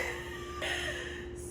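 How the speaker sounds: squeaky breathless laugh; high whispered laugh. Real, not acted. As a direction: wheeze it out up high. Cracking up.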